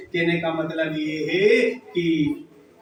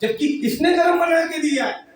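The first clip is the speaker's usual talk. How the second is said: indignant shout; 10 semitones higher than usual. Acted. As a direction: shout the insult back at her. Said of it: "बोले".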